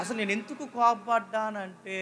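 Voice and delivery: mock whiny stretched-out justification; drawn-out excuse. Preacher mocking an angry person's excuse, drawn out. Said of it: "అని సాగదీస్తా చూసారా".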